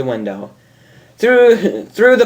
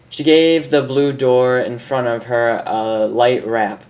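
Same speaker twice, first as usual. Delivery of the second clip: plain reading voice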